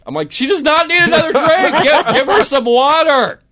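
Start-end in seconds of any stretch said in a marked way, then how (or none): 0.3-1.8 very high, loud exasperated yell; hammed-up shriek of disbelief; mock frantic objection. Man objecting as friends offer her a drink, mock frantic yell. 1.8-3.4 very high, loud exasperated yell; hammed-up shriek of disbelief; mock frantic objection. Man objecting as friends offer her a drink, mock frantic yell.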